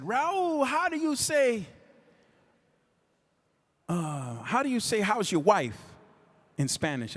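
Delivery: high, raspy-free impression of [name] on the phone. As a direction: imitate [name] calling late at night, voice up high. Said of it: "He said".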